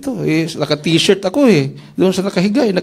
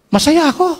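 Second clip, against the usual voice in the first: excited; 12 semitones higher, 4 dB louder than usual. Retold or imitated. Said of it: "sabi na masaya ako".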